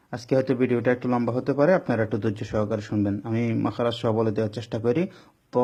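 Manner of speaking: normal talking voice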